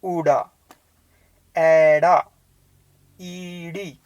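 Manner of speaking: ऊड़ा एड़ा ईड़ी, each with a double sound; Punjabi letter names. Shown as a demonstration. Teacher showing Punjabi letter names in two beats. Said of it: "हम बोलते हैं".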